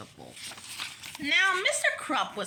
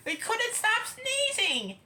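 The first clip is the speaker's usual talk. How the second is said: ordinary delivery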